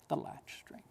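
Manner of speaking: unvoiced hush of The Latch String; whispered title. Shown as a demonstration. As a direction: breathe the title as a secret. Repeats title hushed.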